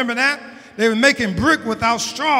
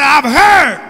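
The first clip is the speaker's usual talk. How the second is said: very loud high "I've heard"; shouted climax; 7 semitones higher, 10 dB louder than usual. Acted. Preacher as God, loud at the peak of the sermon.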